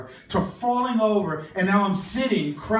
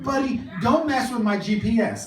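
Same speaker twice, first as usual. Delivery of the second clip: campy sass; 6 semitones higher, 5 dB louder than usual. Retold or imitated. Campy retort while kneeling.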